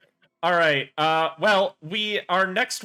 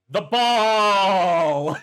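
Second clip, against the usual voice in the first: excited shout; 7 dB louder than usual. Acted. Dog voice shouting.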